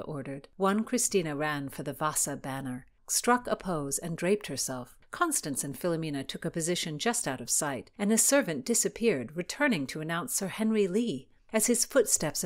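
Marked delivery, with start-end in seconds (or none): none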